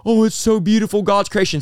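high-pitched, hammed-up weepy wonder; mock tearful awe. New believer crying at a butterfly, mock tearful awe. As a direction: coo as if moved to tears, in retelling. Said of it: "I am crying looking at a butterfly going".

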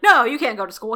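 loud, lightly acted husband's veto; mock refusal. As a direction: put your foot down, half-playing.

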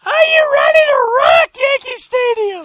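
high, loud rock-concert hype yell; stadium announcer shout. Retold or imitated. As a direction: belt it to a whole stadium. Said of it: "you hear outside".

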